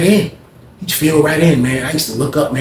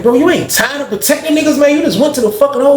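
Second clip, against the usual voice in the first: high, raised voice, half laughing at him; incredulous teasing; 13 semitones higher, 3 dB louder than usual. Retold or imitated. Narrator mocking Neil for going back to protecting, high and loud. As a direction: needle him loudly in disbelief.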